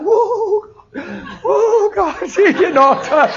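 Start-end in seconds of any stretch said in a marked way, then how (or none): 0.0-2.3 high, breathless whoa with a laugh; charismatic outburst. Retold or imitated. A stranger in the mall overcome, crying out high and laughing. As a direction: overcome by a sudden rush of emotion. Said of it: "She went into charismatic".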